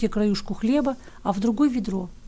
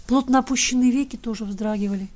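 plain reading voice

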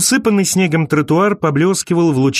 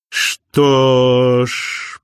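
drawn-out sly smirk; sly drawl. A narrator's reading. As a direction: stretch it out with a sly grin. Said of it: "протянул Винс с хитрой ухмылкой".

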